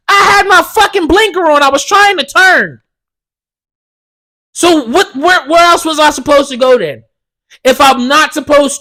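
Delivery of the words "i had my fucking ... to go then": raised high, heated protest; arguing back at the cop, worked up; angry rant. Real, not acted. Ranting at the cop who ticketed him, heated and high.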